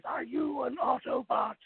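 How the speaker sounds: a hint of Megatron, drawn out. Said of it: "in Megatron's voice".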